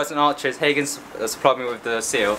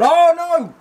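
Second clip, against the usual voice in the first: startled cry; 5 dB louder than usual. Real, not acted. Light suddenly goes out.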